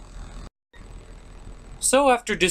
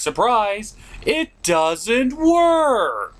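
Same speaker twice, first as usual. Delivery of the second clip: sarcastic reveal; 7 semitones higher, 3 dB louder, much slower than usual. Acted. Sarcastic punchline.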